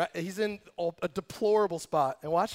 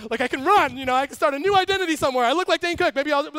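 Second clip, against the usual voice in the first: higher, louder, rushed excited scheming; the preacher pictures himself running off as a prisoner; eager escape plan; 7 semitones higher, 7 dB louder, faster than usual. Retold or imitated. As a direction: rattle off the getaway plan. The speaker imagines himself escaping.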